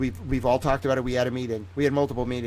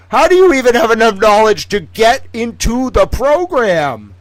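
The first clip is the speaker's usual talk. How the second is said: loud, high, disbelieving rant; incredulous shouting; 9 semitones higher, 9 dB louder than usual. Real, not acted. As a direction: yell it in total disbelief.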